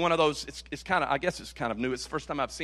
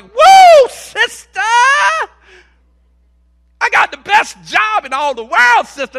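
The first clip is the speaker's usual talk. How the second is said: high, loud whoop, then a bragging cheer; hammed-up happy churchgoer showing off; mock joyful boast; 12 semitones higher, 13 dB louder than usual. Preacher imitating a happy worker bragging to a friend, loud whoop.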